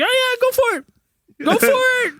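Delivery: put-on high-pitched voice of parents egging a kid on; high, playful go-ahead; mock parental encouragement. Voicing amused parents egging kid on.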